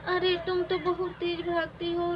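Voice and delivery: ordinary delivery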